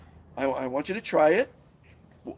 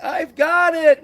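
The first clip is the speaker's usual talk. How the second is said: slow, high, loud yell of bad news; yelled answer; 13 semitones higher, 11 dB louder, much slower than usual. Sick friend yelling back from a distance. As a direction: call it back loud and drawn out. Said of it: "They'd either yell back".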